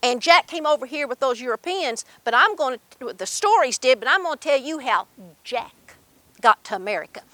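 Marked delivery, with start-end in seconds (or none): none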